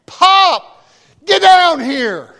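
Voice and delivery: high, loud, slow yell; urgent shout. Retold or imitated. Son yelling up to Pop at the derrick, loud and slow. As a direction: call him down, drawn out and urgent.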